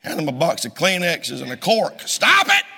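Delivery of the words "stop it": loud, high snapped command; sharp order. Retold or imitated. Pastor scolding a crier, loud sharp order. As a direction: cut the crying off hard.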